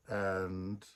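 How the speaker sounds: ordinary delivery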